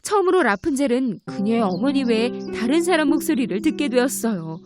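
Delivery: plain reading voice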